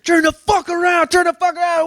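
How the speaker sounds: high barking order to turn around; mock cop yell. Cop yelling at the suspect to turn around. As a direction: yell it like a cop with a gun drawn.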